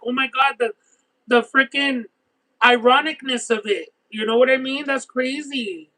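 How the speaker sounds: usual speaking voice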